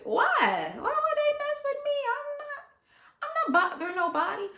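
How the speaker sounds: squeaky, fast, frightened pleading; mock-scared high squeak; high panicked protest. His own scared protest.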